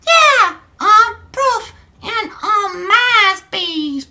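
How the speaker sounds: very high, loud put-on voice; girl's chat voice. Streamer as Sarah answering Donnie in chat, high loud falsetto. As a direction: squeal it like a cartoon girl. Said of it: "forgive my voices".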